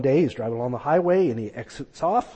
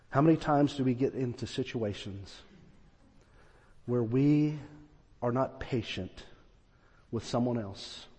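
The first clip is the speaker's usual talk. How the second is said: quiet reflection; 3 semitones lower, 7 dB quieter than usual. Retold or imitated. Reflective inner thought.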